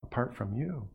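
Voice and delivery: soft, separate emphasis on "Apart from you"; stress on YOU phrase. Shown as a demonstration. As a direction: give "Apart from you" hushed, deliberate weight. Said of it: "That's where the emphasis is".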